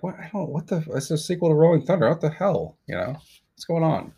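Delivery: high, baffled rush of questions; confused moviegoer. Retold or imitated. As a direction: sputter like a lost audience member. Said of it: "And you're like".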